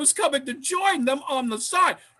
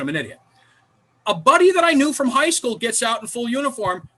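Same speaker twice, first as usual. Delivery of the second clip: normal talking voice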